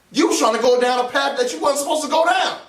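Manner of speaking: normal talking voice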